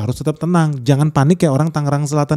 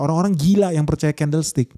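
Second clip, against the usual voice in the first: ordinary talk, nothing marked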